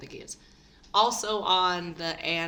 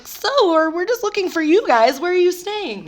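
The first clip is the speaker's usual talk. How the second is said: fake innocent; 9 semitones higher, 9 dB louder than usual. Retold innocent cover story.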